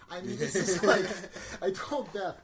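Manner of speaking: loud, excited rush. Real, not acted. Excited fan gushing to friends, loud. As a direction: burst out with excitement.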